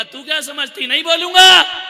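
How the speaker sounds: husband shouting at wife; angry husband. Retold or imitated. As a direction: yell the refusal back. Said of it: "पति ने कहा".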